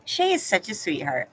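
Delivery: ordinary talk, nothing marked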